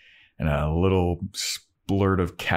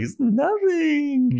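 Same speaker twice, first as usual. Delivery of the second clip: very high, slow bashful squeak; coy Buffy; much slower than usual. Retold or imitated. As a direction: brush it off in a tiny coy voice. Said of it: "Buffy's like".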